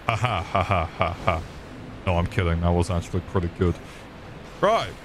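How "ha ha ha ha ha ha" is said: deliberately hollow ha ha ha; fake laugh. Shown as a demonstration. Streamer to viewers, a deliberately fake laugh. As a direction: laugh like you don't mean it at all. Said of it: "my best fake laugh".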